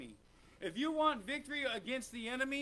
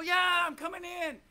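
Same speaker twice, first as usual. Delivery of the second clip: eager believer; 8 semitones higher, 8 dB louder than usual. Acted. Believer answers God eagerly.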